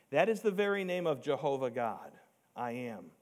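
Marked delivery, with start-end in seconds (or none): none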